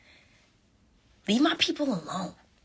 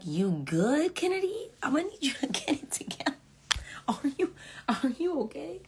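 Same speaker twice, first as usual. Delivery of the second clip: teasing big sister; 9 semitones higher, 3 dB quieter than usual. Retold or imitated. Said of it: "I was like".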